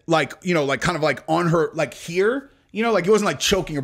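usual speaking voice

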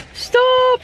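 high gasp then shrieked stop; excited squeal. Real, not acted. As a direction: squeal with delight. Excited at toy.

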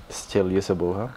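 normal talking voice

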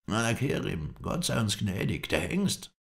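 high, alarmed wail; horrified high-pitched exclamation; Mohammed Emin's cry. A narrator's reading. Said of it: "schrie Mohammed Emin".

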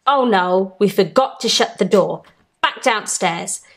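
ordinary delivery, nothing marked

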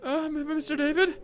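put-on high, slow little-boy voice; timid child Fluffy. Fluffy recognising Mister David, timid child voice.

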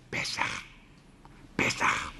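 voiceless mock secret whisper; whisper in the ear. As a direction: whisper each word into her ear. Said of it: "אומרים לה באוזן". (לחישה מבוימת באוזן)

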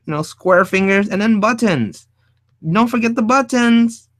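high, loud mock enthusiasm; animated, hamming up the joke; playful excitement. Real, not acted. Joking about old-fashioned robot designs.

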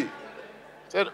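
ordinary talk, nothing marked

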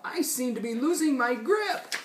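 high, worried character voice; story beast in trouble; yelping Beast. A narrator's reading. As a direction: yelp it out, pitched well up. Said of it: "Beast asked with a yelp".

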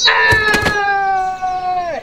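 drawn-out high frightened scream; a long startled yell. Acted. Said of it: "And he's like".

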